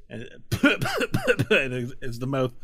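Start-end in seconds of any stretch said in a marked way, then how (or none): 0.3-1.4 high laugh. Real, not acted. GM laughs at the scene.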